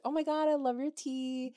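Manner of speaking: high, excited gush; imitating a gushing compliment. Britt praising a bedazzled tee, high gushing. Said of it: "she's like".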